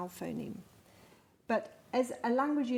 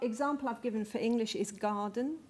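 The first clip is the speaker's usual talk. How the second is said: ordinary talk, nothing marked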